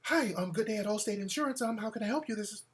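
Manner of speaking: soft, polite office phone voice; professional receptionist. Retold or imitated. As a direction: answer like a proper receptionist. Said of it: "She sounded professional".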